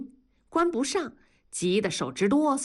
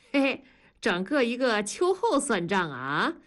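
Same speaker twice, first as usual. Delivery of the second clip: ordinary delivery, nothing marked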